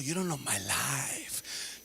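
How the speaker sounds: low, slow defensive retort; defensive mutter. Retold or imitated. Student brushing off the mentor, low and slow. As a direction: mumble a touchy denial.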